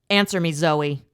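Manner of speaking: loud, sharp snap; snapping. Acted. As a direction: snap the order at her. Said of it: "he snapped".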